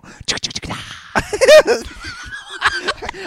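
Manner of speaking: high rapid woodpecker laugh; rat-a-tat woodpecker. Pretend. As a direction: cackle like Woody Woodpecker. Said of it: "my Woody Woodpecker impression".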